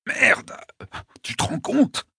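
strained, gasping disbelief; panting in pain. A narrator's reading. Wounded Mike complaining to Mérine, panting. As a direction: pant it out, hoarse with pain. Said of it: "haleta-t-il".

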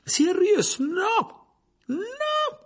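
high, full-force desperate yell. A narrator's reading. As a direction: scream out to stop him.

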